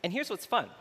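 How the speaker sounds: ordinary talk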